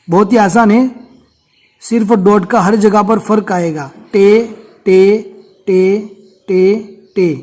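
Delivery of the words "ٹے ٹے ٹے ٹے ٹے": ٹے with a long close e, majhul. Shown as a demonstration. Drilling pupils on the letter, ٹے said majhul. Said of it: "اس کو بھی مجہول پڑھنا ہے".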